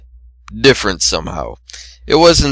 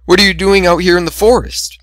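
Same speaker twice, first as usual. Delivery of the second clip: curious mare voice; 9 semitones higher than usual. A narrator's reading.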